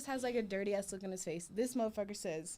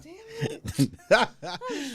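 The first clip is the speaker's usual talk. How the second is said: laughing; 10 semitones higher, 10 dB louder than usual. Retold or imitated. Disappointed over the Plan B.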